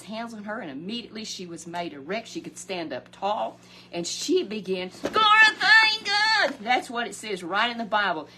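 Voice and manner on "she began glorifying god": high, loud, slow ringing praise; preacher soaring as the woman praises God; preaching swell. Acted. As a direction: lift it up like a shout of glory.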